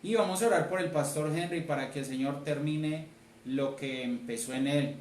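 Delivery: ordinary talk, nothing marked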